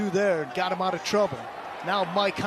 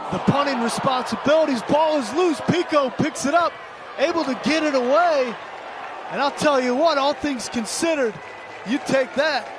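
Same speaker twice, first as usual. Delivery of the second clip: rising burst of excitement, louder and higher; excited play call; 6 semitones higher, 4 dB louder than usual. Real, not acted. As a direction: call the fumble live as it happens. Loose ball on the punt.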